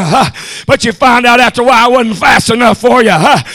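shouted preacher's whoop, punching each line; preaching at full force. Acted. Preacher roaring at the congregation about the mule. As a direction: drive the sermon home, chanting the climax. Whooping sermon on fast mules.

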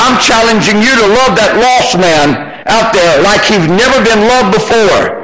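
high-pitched, driving pulpit delivery; full-force preaching. Real, not acted.

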